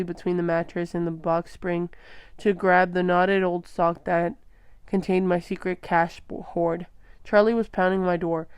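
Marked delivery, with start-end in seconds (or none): none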